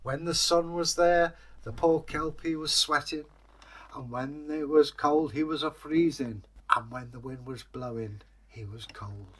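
ordinary delivery, nothing marked

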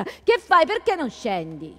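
loud and high call up the stairs. Acted. Husband in the courtyard calling up to Enzo, loud. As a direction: shout up to the window, then ease off slowly.

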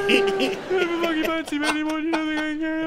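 very high, loud howling sob-wail; girlfriend's sobbing rant compared to Chewbacca, high howling wail. Acted. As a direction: wail it like a wookiee in tears. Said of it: "fucking Chewbacca's in the car".

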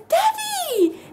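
put-on high excited child cry of Daddy; imitating the baby's happy squeal; baby calling daddy. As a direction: squeal it like a delighted toddler. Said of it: "he's like".

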